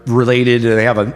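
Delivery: ordinary delivery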